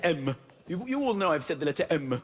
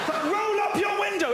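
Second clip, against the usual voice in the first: dad shouting; 13 semitones higher than usual. Retold or imitated. Dad ordering the children in the car to shut the window. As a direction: bark it over motorway noise.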